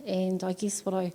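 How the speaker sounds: normal talking voice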